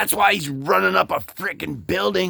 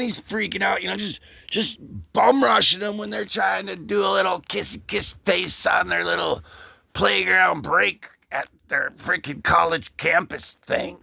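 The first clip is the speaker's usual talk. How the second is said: ordinary talk, nothing marked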